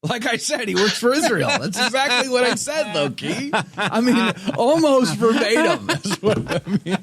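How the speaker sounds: high, laughing, joking agreement; mock agreement. Host jokes with the commenter, laughing sarcastic agreement.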